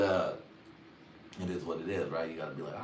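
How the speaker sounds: usual speaking voice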